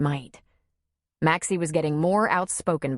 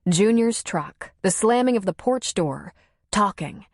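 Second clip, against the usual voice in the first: ordinary delivery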